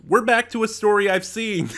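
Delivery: gleeful return to a story she knows; excited. Real, not acted. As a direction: announce it with real delight.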